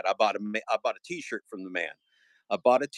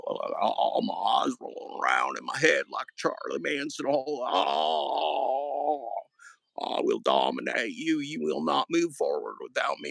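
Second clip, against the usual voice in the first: crazy villain impression, drawn-out moans building to a shout; Pedro; 3 semitones higher, slower than usual. Streamer threatens to dominate as his rival, hammed-up crazy voice. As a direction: play a raving madman, swooping in pitch. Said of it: "I'm like Pedro".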